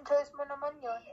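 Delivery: normal talking voice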